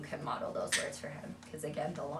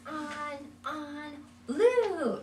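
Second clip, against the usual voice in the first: high single words, "blue" louder; simple statements; 10 semitones higher, 4 dB louder, much slower than usual. Shown as a demonstration. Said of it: "better just to make statements".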